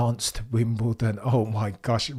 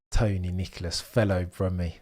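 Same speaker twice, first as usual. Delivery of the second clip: ordinary delivery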